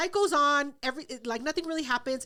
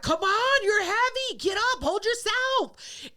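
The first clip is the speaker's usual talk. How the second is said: put-on higher, drawn-out pleading at a drunk; imitated fed-up girlfriend, pitched up; exasperated urging; 6 semitones higher, slower than usual. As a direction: strain to haul up a heavy drunk. Girlfriend hauling up drunk boyfriend.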